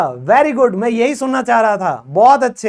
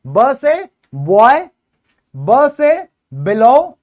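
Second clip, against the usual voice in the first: slow, deliberate ब से बॉय; teacher slowly modelling example words; slow teaching demo; 4 dB louder, much slower than usual. Shown as a demonstration. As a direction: spell out each word slowly for the class. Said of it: "कौन कौन से शब्द".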